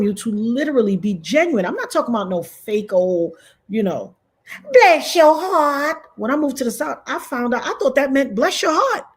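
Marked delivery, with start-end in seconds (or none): 4.7-6.0 high, slow, syrupy mock Southern lady; fake sweet "bless your heart". Streamer mocking a fake-nice Southern blessing. 8.3-9.0 fake sweet "bless your heart". Streamer mocking a fake-nice Southern blessing.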